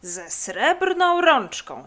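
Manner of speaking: victorious, stretched confirmation; triumphant. Acted. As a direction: call it out in slow triumph, swelling on srebrną. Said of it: "zawołał triumfalnie leniwiec".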